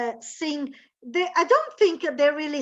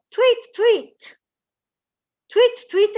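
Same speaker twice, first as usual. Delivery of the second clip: high, louder tweeting; bird tweets; 8 semitones higher, 7 dB louder, slower than usual. Shown as a demonstration. Teacher tweeting like a canary.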